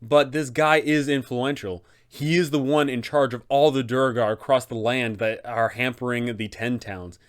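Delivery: usual speaking voice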